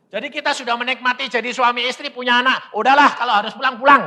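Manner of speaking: high, loud, insistent argument; exasperated husband persuading his wife; heated acted reasoning. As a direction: press the point, high and worked up.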